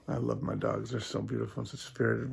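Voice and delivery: ordinary delivery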